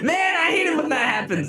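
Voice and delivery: high-pitched comic wail; mock exasperation. Mock outrage at coincidence.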